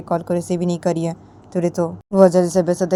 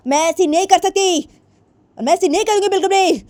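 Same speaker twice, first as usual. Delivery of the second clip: high, pleading protest; a daughter refusing her parents, pitched high; upset daughter voice; 11 semitones higher than usual. Acted. Daughter protesting to her parents, high and upset.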